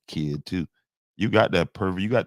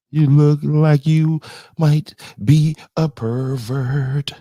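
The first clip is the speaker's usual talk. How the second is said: higher and louder, singsong taunt; mocking high voice; 8 semitones higher, 8 dB louder than usual. Real, not acted. Streamer mocking a viewer, high sneering taunt. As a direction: tease him in a high sneering voice.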